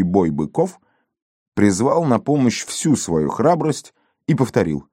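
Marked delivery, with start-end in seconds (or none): none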